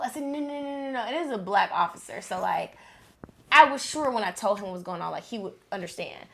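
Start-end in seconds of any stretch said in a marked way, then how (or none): 0.2-1.0 high, tearful, pleading string of no's; retold crying plea. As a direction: beg through tears, the last no's slowed way down. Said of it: "I'm crying".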